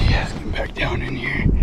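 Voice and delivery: soft whisper. Real, not acted. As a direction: keep it hushed by the water. Sneaking up on a fish.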